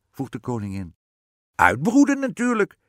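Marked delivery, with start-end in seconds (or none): 1.5-2.8 high, loud witch retort; piercing storybook witch; impatient witch. A narrator's reading. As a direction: shout it as an obvious answer, witch-style. Witch answers queen impatiently.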